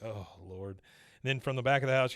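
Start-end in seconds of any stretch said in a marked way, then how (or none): 0.0-0.8 weary groan. Retold or imitated. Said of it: "Hank's like".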